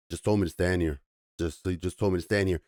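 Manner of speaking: lightly voiced gentle big alien; docile alien. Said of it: "docile character".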